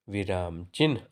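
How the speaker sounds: ordinary talk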